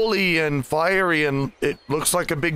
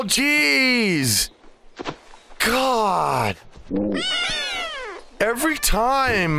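mock-whining kid with stretched-out words; exaggerated exasperated whine; whiny protest; 6 semitones higher, slower than usual. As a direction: complain to Mom like a put-upon child. Kitten protests being fetched by mother.

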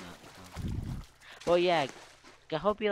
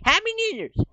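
very high, loud mock celebration cheer; high cracking shout of celebration; imitating cheering revellers; 13 dB louder than usual. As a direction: squeal it like an over-excited party-goer. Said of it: "be like those people, like".

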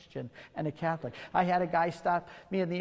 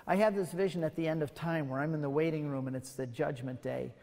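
ordinary talk

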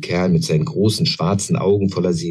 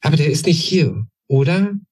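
much higher, urgent little-goblin questions; eager young goblin; 10 semitones higher than usual. A narrator's reading. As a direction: press him hard for an answer. (viel höher, drängender kleiner Kobold)

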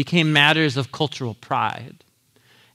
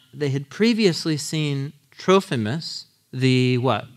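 ordinary talk, nothing marked

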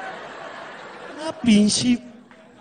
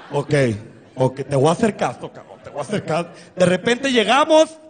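ordinary talk, nothing marked

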